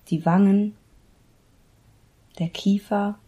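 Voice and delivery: measured meditation guide with long pauses; unhurried Yoga Nidra instruction, pausing between body parts; guided meditation. Acted. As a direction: lead them into relaxation, phrase by phrase.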